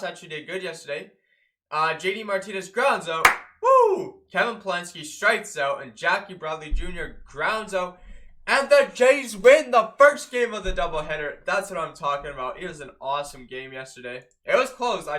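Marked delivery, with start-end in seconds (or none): 3.6-4.2 high, loud "woo"; excited whoop. Real, not acted. Jays fan cheering an out, excited whoop. 8.5-11.4 loud, raised victory call; triumphant announcement. Real, not acted. Fan announcing the Jays' win, triumphant.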